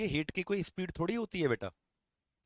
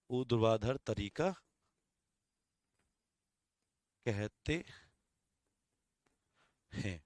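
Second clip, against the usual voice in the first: dictation. Shown as a demonstration. Dictating Hindi definition.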